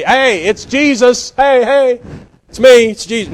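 high, loud, stretched-out hailing; mock casual greeting. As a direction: call out like you're hollering to friends from afar. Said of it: "He didn't say".

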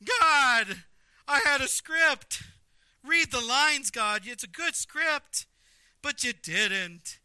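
high, loud, drawn-out whining protest; hammed-up temper tantrum; tantrum-throwing Jonah. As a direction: wail at God like a thwarted child. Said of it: "Jonah is throwing a temper tantrum".

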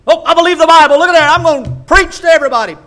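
high, loud hammed-up fervour; mocking zealous convert. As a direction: parody an overeager new convert at full volume.

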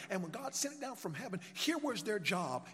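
ordinary talk

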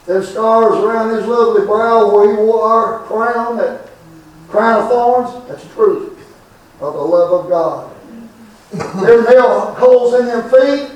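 impassioned street sermon, rising and falling; preaching. Acted.

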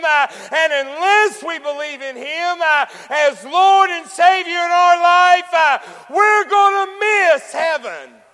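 loud, high preacher's shout; fiery preaching. Acted. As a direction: preach it out at full force, rising. Preaching at full force.

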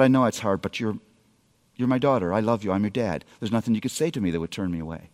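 soft, low, fast comforting dad; a father soothing his daughter at night; tender reassurance. Retold or imitated. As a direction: coax her gently to open up.

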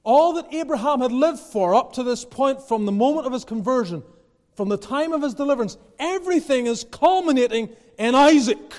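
urgent, high-pitched sermon delivery; impassioned preaching. Real, not acted. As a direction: build it to an emphatic climax. Preaches climax with raised force.

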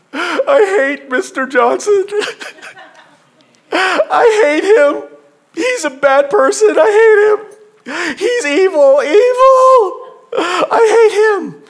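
put-on high, loud, tearful little boy's wail; crying boy. A preacher voicing a crying schoolboy, high and tearful. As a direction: wail it like a sobbing kid who got spanked.

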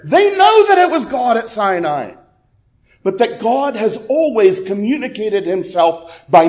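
urgent, insistent preacher; impassioned preaching. Real, not acted. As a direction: press the case hard on the congregation, pitch held high. Preaching at full force.

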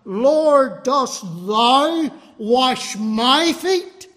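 "thou" and "my" hit hard, slow and loud; stress on thou and my. Acted. Peter questioning the Lord, stress on "thou" and "my". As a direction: lean heavily, indignantly on "thou" and "my". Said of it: "emphasize the word thou and my".

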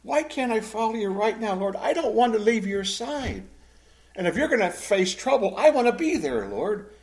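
high-pitched, wounded plea; hurt pleading. Acted. Peter asking his Lord where he is going, hurt pleading. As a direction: plead as if hurt at being left behind. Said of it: "Hear the hurt in this".

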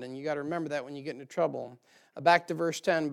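ordinary delivery

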